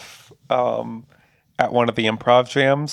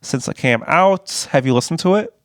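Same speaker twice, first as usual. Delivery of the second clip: ordinary delivery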